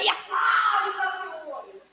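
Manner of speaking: high, strained mock shout; wrong, shouting version. Shown as a demonstration. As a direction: bellow it like a harsh accuser. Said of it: "Não é". (grito forçado e agudo, do jeito errado)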